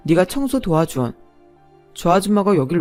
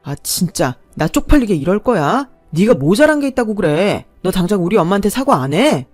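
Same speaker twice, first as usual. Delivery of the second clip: angry demand; 3 semitones higher, 7 dB louder than usual. A narrator's reading. Man demands apology.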